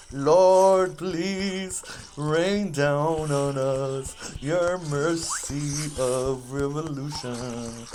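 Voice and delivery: slow, drawn-out mock prayer to the Lord. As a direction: plead with heaven in slow, hammed-up reverence.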